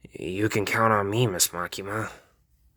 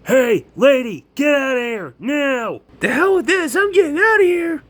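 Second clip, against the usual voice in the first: much higher, loud, panicked shout; frantic bystander yelling; 9 dB louder than usual. Acted. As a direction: yell and run.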